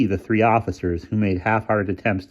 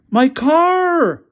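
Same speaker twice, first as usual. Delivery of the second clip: very high, louder howl; howl of dismay; 8 dB louder than usual. A narrator's reading. Developer howling from the police cruiser floor. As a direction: wail for a ruined car. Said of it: "howled the developer".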